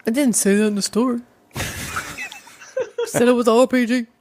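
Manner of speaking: put-on street slang voice; homie voice. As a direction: talk like a homie. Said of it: "Why are you talking like that?".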